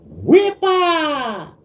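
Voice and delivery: high, loud festive shout; parade cheer. Retold or imitated. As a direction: call it out like at the parade. Parade call, Puerto Rican pride.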